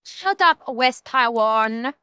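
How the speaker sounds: put-on slow, drawn-out Chinese accent. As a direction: Chinese accent, barking the insult. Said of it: "I tried to go with the Chinese accent on this one".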